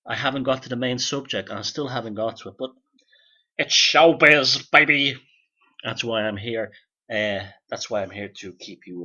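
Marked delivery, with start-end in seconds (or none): 3.6-5.2 mock showman. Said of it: "mock showman flourish".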